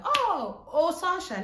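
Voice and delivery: high, loud squeal of recognition; delighted surprise. Retold or imitated. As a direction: gush on hearing he is nearby. Acted delighted reply on the phone.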